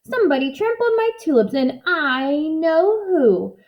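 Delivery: high witch voice, last words slow and menacing; fume, then drag out the threat; angry little witch. A narrator's reading. Little witch vowing revenge over her tulips, high and menacing.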